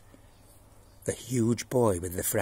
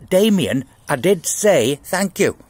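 exasperated teacher; 5 semitones higher, 8 dB louder, slower than usual. A narrator's reading. Teacher interrupting pointedly.

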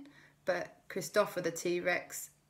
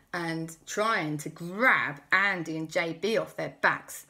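ordinary delivery, nothing marked

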